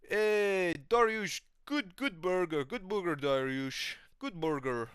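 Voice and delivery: high, stoned put-on voice; Shamil. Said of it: "He's like".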